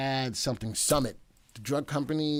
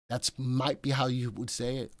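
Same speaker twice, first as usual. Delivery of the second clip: normal talking voice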